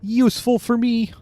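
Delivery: high, whiny frog puppet; Kermit voice. Acted. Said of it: "my Kermit voice".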